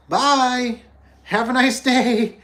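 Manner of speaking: high, slow sing-song farewell; bright chirpy "have a nice day" at the door; cheery goodbye. Retold or imitated. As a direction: sing it out like a happy shopkeeper. Cheerful send-off to a customer.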